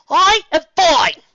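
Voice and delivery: high, slow, forced loud reply; insistent shout. Acted. Octavia hides her strain, loud and slow. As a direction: insist you're fine, too loudly.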